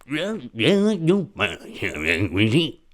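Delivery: high then very slow put-on voice; a hint of a funny voice. Shown as a demonstration. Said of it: "that voice is just me going".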